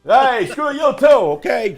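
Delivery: put-on New York mobster accent. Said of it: "all of our New York audiences have unsubbed".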